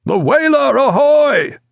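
loud, very high calling out; ship's hail. A narrator's reading. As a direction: hail the whaler across the sea. Hailing another ship.

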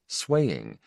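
ordinary delivery

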